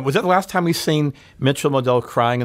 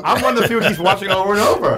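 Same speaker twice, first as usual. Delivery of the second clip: laughing brag; 8 semitones higher, 3 dB louder, faster than usual. Real, not acted. Joking about rewatching the episode.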